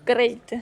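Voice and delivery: Great with a trilled Spanish r; rolled r. Shown as a demonstration. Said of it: "il fallait que je roule les R".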